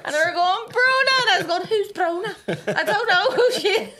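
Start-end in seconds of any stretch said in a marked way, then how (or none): 0.7-1.1 high, loud mock call of a name; hammed-up exasperated shout; imitated insistent call. Friends on the phone calling her out, imitated insistent shout. 1.7-3.9 high, loud fake Scouse protest; laughing through an imitated Scouse accent; put-on Scouse accent. Denying who she is to her friends, put-on Scouse. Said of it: "I put an accent on".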